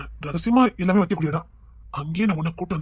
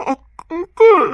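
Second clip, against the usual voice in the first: loud, high startled cry of uncle; shrill cry; 11 semitones higher, 11 dB louder, much slower than usual. Acted. Girl startled, crying out to the uncle. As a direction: call out sharply, high and loud.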